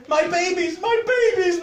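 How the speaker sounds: high, loud maternal shriek; panicked scream. A narrator's reading. Mother sees her child bitten, high panicked scream. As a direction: scream it out, climbing on the repeat. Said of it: "Missus Middleton screamed".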